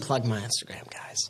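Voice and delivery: a real whisper, as if sharing a secret. Real, not acted. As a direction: hush it, barely voiced. Denies plugging his Instagram.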